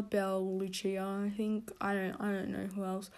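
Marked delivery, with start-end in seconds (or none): none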